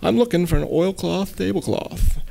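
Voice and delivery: light imitation of a thick Maine accent. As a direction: say it like a Maine local at a flea market. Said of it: "this thick Maine accent".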